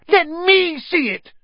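high, slow, loud shriek; demanding. A narrator's reading. Son demands a look, high yelling. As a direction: insist in a piercingly high yell. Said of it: "demanded Dudley".

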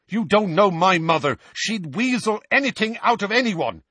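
high, loud irritable snap; heated, scornful retort; snapped. A narrator's reading. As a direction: cut them down crossly. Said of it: "Seamus snapped at him".

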